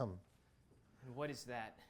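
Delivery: suspicious low question. Acted. Suspicious soldier low and slow.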